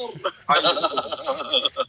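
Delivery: high, rattling staccato laugh; high cackle. Real, not acted. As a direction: cackle high in rapid bursts. Laughs during battle. (قهقهة حادة متقطعة)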